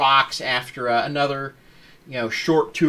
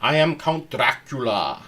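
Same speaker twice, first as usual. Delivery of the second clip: terrible Bela Lugosi Dracula. Retold or imitated. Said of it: "I'm doing a terrible accent".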